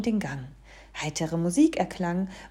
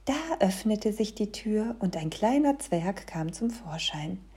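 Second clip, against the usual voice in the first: plain reading voice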